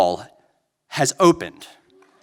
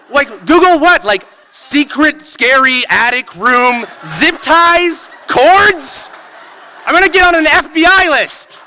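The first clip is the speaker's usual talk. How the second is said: panicked outburst; 9 semitones higher than usual. Retold or imitated. Narrator to his wife about the attic, panicked high shout. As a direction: squeal it out in full panic, voice jumping up.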